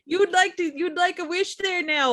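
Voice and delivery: put-on high, sing-song northern Wisconsin accent; chirpy Wisconsin genie offering a wish; northern Wisconsin accent, friendly Midwest lilt. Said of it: "northern Wisconsin accent".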